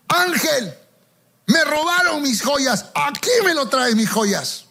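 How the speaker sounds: invocación imperiosa fingida, con voz más aguda; orden al ángel. Retold or imitated. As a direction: remedo de quien manda al ángel como a un sirviente. Mock command to an angel. (exigencia teatral, en tono alto)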